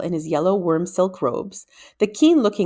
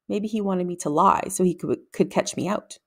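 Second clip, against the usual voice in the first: ordinary delivery, nothing marked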